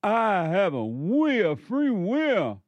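high, mocking take on a smug believer; lifted, mocking voice for free will; mocking quote. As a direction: parrot their claim back with mock pride. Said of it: "you say".

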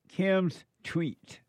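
usual speaking voice

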